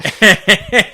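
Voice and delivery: a loud high burst of laughter. Real, not acted. Host laughing at the hero and idiot line.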